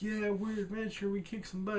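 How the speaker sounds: high-pitched eager sidekick voice; imitated eager little hanger-on; Horror. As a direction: chime in high, like an adoring tagalong. Retelling Horror cheering along with Adventure.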